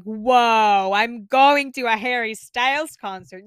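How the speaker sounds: loud, thrilled self-quote; excited gasp. Retold or imitated. Fan replaying her own reaction to having concert tickets, excited. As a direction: say it like you just remembered your tickets. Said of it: "I'm like".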